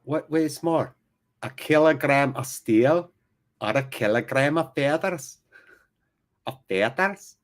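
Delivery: hammed-up Scottish accent. Commentator doing a film riddle for fun, amateur Scottish accent. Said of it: "working hard on my Scottish accent".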